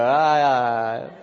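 laughing, then a loud mock "ah, leaven"; laughing mock realization. Pastor joking to the congregation, laughing mock realization. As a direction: play the dawning "oh, leaven" through laughter. Joking about disciples meeting them in heaven.

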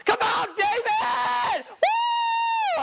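imitating mom's high yell from the stands; a sudden high whoop, crowd cheer. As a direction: belt it out like a proud mom in the bleachers. Mom yelling from packed bleachers.

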